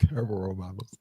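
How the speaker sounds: breathy low whisper, half to himself; whispered aside. Real, not acted. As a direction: mutter it under your breath like a secret. Joking aside after a clip.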